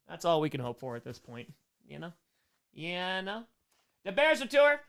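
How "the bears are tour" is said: high, loud Chicago "Bears"; hammed-up Chicago accent on "Bears"; punchy mock Chicago fan; Chicago fan catchphrase.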